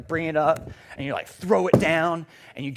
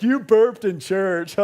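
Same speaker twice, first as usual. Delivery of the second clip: friend helpless with laughter, mimicked; drawn-out, louder mock taunt; Seth; 5 dB louder, much slower than usual. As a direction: stretch it out slowly, as if cracking up. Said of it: "my best friend Seth is, like, literally rolling on the floor".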